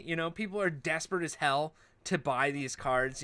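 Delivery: normal talking voice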